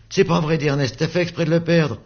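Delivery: put-on high accusing kid's voice; childish accusation. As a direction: blame him hotly. Dispute d'enfants. (accusation d'enfant aiguë)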